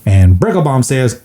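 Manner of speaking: ordinary talk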